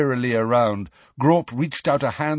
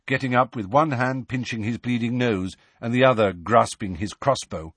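ordinary delivery, nothing marked

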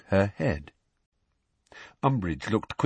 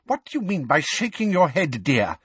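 testy; 6 semitones higher than usual. A narrator's reading. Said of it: "in a testy voice".

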